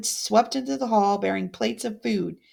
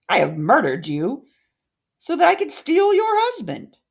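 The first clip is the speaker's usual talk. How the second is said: villain's confession; 5 semitones higher, 6 dB louder than usual. Acted. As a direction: spit out the confession in triumph. Odette confessing murder.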